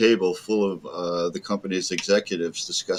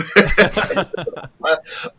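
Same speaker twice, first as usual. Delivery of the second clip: high laugh; 10 semitones higher, 4 dB louder than usual. Real, not acted. Guest laughing at his own story, real laugh.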